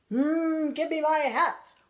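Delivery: growing impatient with the monkeys, hammed-up; storybook huff and demand; mock angry demand. Son tries the angry tricks.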